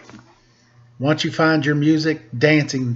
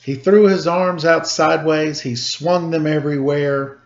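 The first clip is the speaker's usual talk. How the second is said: gentle bedtime story reading; lilting storybook read; story narration. A narrator's reading. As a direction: read it like a rhyming picture book for kids. Reads a picture book aloud.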